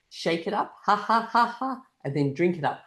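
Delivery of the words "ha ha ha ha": loud, high ha-ha beats; punchy deliberate laugh; milkshake laughter. Shown as a demonstration. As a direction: shake out a forced laugh. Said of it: "it's called the milkshake laughter".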